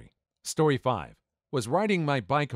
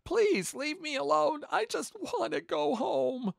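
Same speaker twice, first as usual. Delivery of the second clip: put-on crying kid. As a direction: beg through tears like a scared child. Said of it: "and started crying".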